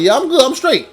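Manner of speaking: high, brushing-off retort to a taunt; high-pitched cocky reply. Retold or imitated. Camp worker answering the lion's imagined taunt, high cocky reply.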